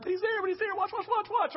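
high, rapid-fire urgency without volume; urgent viewer. Retold or imitated. Preacher urging Sarah to see Isaac is alive, high urgency. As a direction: squeal it anxiously at the screen. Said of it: "And I'm like".

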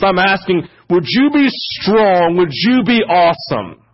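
impassioned preaching. Acted. Preacher prays his weakness before congregation, fervent pleading. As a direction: cry out to God in sermon style.

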